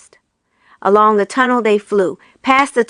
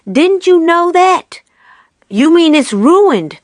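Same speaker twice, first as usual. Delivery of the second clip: character voice; 8 semitones higher, 4 dB louder, slower than usual. A narrator's reading. Women discussing ruined tail.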